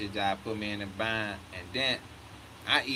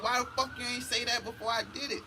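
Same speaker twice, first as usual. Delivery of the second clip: mock outrage; 11 semitones higher, faster than usual. Retold outburst at clerk.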